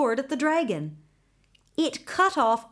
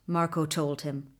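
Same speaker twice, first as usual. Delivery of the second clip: plain reading voice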